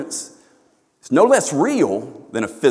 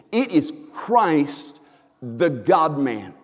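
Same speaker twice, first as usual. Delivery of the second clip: normal talking voice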